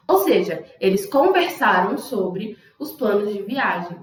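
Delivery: normal talking voice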